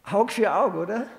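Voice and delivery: laughing, half-joking smug tit-for-tat; mock retaliation logic. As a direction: toss it off as a cheeky excuse. Mocks the retaliating brother's logic. (lachend, spöttisch gesagt)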